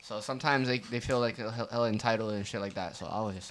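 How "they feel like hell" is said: usual speaking voice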